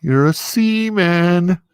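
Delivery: slow, drawn-out mocking seaman; teasing drawl. Teasing his Navy friend with a slow, mocking drawl. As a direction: stretch it out to rub it in.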